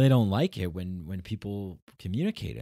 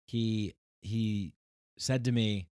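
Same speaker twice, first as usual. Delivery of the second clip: ordinary delivery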